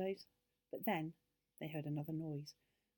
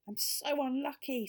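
higher, louder storybook lament; sighed complaint; 7 semitones higher, 5 dB louder than usual. A narrator's reading. Beth after getting splashed, sighed complaint. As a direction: bemoan your luck, lightly acted. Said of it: "sighed Beth".

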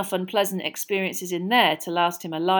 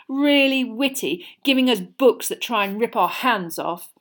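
sneering; 4 semitones higher, 7 dB louder than usual. A narrator's reading. Malfoy sneering on.

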